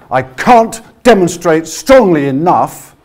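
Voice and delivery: slow, loud, every opening consonant hit hard; strong consonants. Shown as a demonstration. Coach demonstrating punchy projection from the diaphragm. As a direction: kick each word off from the belly. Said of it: "putting a strong consonant on the beginning".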